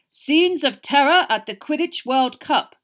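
loud, slow, dramatic headline; headline reading. A narrator's reading. Harry reading the newspaper headline, loud and dramatic. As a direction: announce it like alarming front-page news.

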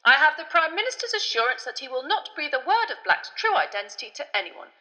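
high, loud, exasperated minister; irritable official. A narrator's reading. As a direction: protest tetchily, voice pitched well up. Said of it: "said an irritable Fudge".

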